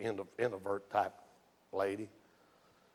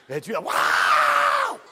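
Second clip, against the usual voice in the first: imitated whoop; 12 semitones higher, 10 dB louder, much slower than usual. Wife shouting at funerals.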